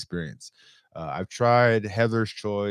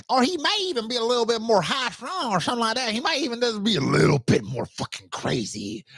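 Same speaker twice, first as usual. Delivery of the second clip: higher, hopping between character voices; shifting character voices; 10 semitones higher than usual. Shown as a demonstration. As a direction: show off a range of put-on voices for different characters. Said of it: "see how I just transitioned through all".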